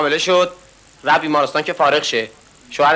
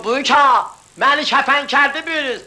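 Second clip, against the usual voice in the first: higher hawker's call; mock hawker call; 8 semitones higher, slower than usual. Cigarette seller calls to Buyuk, higher mock call.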